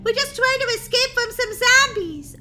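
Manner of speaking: high, loud outburst; exasperated scolding. Acted. Sister packing to escape zombies, exasperated high scolding.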